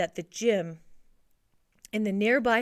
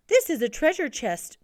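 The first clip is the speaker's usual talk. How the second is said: higher-pitched, mildly excited call; excited cry; 6 semitones higher, 3 dB louder than usual. A narrator's reading. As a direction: call it out to a trained pet. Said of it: "cried Lewis".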